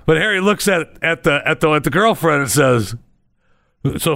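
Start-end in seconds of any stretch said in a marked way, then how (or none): none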